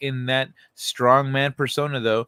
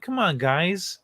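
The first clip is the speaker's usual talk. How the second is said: pitched-up mock whining of the official; lightly voiced pleading bully, higher; mock whining plea, higher; whiny plea; 6 semitones higher than usual. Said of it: "now he's like".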